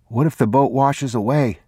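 put-on high, small child's voice; anxious little boy pitched high; young boy. A narrator's reading. Boy worrying to his father about the boat, high childlike voice.

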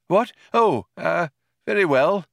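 put-on high, hesitant old man; slightly taken aback. A narrator's reading. Old man is told to go, slightly taken aback. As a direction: falter a little, mildly surprised. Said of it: "slightly taken aback".

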